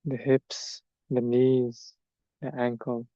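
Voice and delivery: measured meditation guidance, long silences between phrases; guided meditation. Acted. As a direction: let each body part settle before the next. Leads standing meditation.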